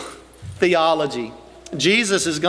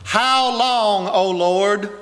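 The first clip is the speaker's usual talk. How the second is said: pleading cry to God; 3 semitones higher, much slower than usual. Retold or imitated. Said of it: "this same phrase repeated".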